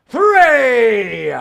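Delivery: loud, high, drawn-out shout; countdown climax. Real, not acted. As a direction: stretch the final count into a big holler. Last count before a fire stunt.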